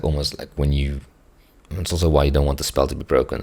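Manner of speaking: normal talking voice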